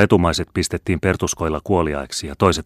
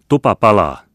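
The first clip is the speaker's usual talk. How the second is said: high, loud fire warning; urgent raised shout; shouted alarm; 5 semitones higher, 9 dB louder than usual. A narrator's reading. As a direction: cry out that there is danger. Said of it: "huudettiin samalla kaikilta tahoilta".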